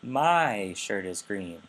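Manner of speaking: MY drawn out, pitch swinging up and down; slow focus intonation on "My"; focus stress on MY. Shown as a demonstration. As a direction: stretch "My" with a rise and fall. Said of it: "focus intonation that's kind of going up and down".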